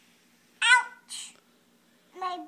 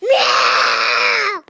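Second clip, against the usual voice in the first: monster yowl; 3 semitones higher, 20 dB louder than usual. Pretend. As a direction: roar like a monster cat. Child as kitty monster.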